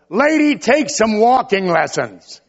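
rude churchgoer. Retold or imitated. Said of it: "Would you say to her".

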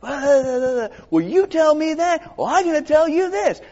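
high-pitched, hammed-up bickering retort; put-on squabbling voice, dragged out; mock defensive. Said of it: "now I'm being defensive".

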